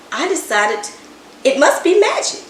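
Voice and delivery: usual speaking voice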